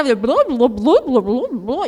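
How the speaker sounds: blu blu abafado e mais alto, como ouvido debaixo d'água; imitando vozes abafadas. Retold or imitated. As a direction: imite vozes ao longe ouvidas dentro da piscina. (imitação de vozes abafadas debaixo d'água)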